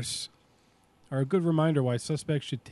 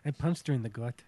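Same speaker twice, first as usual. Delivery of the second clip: put-on soft, low, muddled Scottish-ish accent; botched Scottish-sounding accent; 4 semitones lower, 7 dB quieter, faster than usual. Host badly fakes a horse owner's quip, accidental Scottish accent. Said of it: "a fucking Scottish accent".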